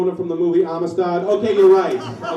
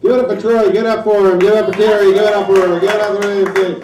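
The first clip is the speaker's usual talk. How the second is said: loud, higher mock applause call; mock emcee shout; 4 semitones higher, 13 dB louder, faster than usual. Comic mocking the crowd with a loud hype call. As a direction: bellow it like a cheesy host. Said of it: "comic mocks the applause call".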